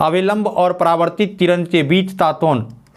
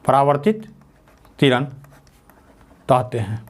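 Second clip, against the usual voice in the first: dictation; 3 semitones lower than usual. Shown as a demonstration. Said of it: "तो लिख लीजिए".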